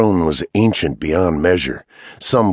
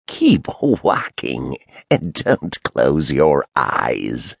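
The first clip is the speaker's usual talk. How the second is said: slow, rasping witch voice; old crone voice; 3 semitones lower, slower than usual. Acted. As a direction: drag it out like an ancient hag. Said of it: "said the crone".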